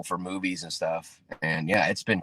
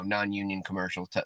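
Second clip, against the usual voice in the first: ordinary talk, nothing marked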